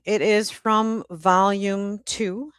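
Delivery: normal talking voice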